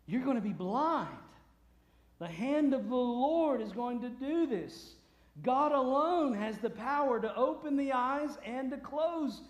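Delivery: thundering pronouncement. Acted. Paul telling a son of Satan his punishment, thundering. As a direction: pronounce the sentence with preacher's force. Acting Paul's judgment on sorcerer.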